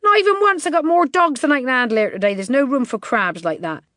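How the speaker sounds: high, loud flat refusal; scornful bookie refusing. A narrator's reading. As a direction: shut him down hard. Feezy refuses.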